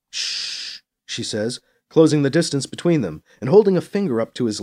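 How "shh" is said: soft, drawn shush; hushing shh. Acted. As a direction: gently silence someone mid-word. Hushing a lover in the story.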